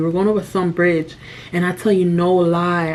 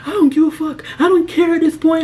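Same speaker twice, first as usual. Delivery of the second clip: higher, louder mock yell of the co-driver; retold angry outburst; 9 semitones higher, 3 dB louder than usual. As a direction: play him snapping back, not caring anymore. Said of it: "he lost it too".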